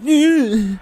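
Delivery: high, loud held squeal; imitated high-pitched whine of pain; mock pained squeal. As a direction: squeal like someone just got punched, hammed up. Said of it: "the noise he made when I".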